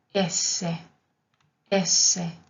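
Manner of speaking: "S" as esse, holding the double s; double consonant. Shown as a demonstration. Teacher demonstrating Italian S twice, deliberate double-consonant demo. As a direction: say "S" in two beats, es-se. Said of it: "igualmente con doble consonante".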